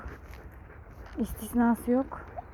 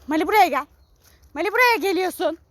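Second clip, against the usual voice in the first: firm call; 5 semitones higher, 6 dB louder than usual. Real, not acted. Calling the dog firmly.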